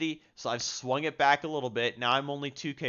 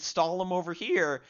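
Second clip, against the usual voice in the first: voice crack; 6 semitones higher than usual. Real, not acted. Commentating his own game, voice cracking. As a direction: pitch jumps up and cracks mid-phrase. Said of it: "that was a voice crack".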